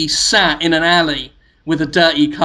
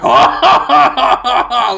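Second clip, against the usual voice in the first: mock laugh; 7 semitones higher, 6 dB louder than usual. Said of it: "And then you go".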